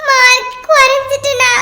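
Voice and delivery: tearful schoolgirl, dragged-out words; acted childish crying; crying pupil. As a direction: sob it to the teacher. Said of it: "ஏன் அழுவுற?".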